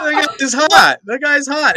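high-pitched, laughing enthusiasm; gushing excitement. Real, not acted. Guest agreeing about actor crush, real gushing excitement. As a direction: gush it way up high.

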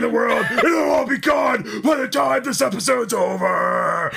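high, loud wrestling promo shout. Pretend. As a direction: yell it like a pro wrestler hyping a match. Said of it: "do it like a wrestling promo".

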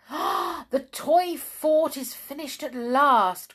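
excited cry. Acted. Wobbly man showing Jolly the fort, excited cry. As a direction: announce the news with delight. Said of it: "cried Mister To and Fro".

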